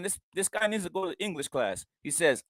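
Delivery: ordinary talk, nothing marked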